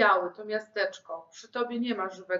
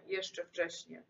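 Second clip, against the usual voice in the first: softer, dying-away repeat; fading echo; 3 semitones lower, 8 dB quieter, slower than usual. Acted. Girl repeating her plea to the ghost, fading. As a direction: let it fade out like a sigh. Echo fades to near nothing.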